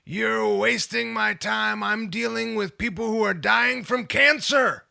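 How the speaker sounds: hammed-up scolding from a grumpy grandpa doctor; imitated yelling doctor. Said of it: "he yelled at me about".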